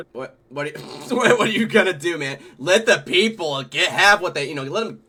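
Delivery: high and loud, throwing it up in the air; excited mock shrug. Telling the soundman to let the crowd have it, loud and high. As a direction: act out the helpless grin, loud.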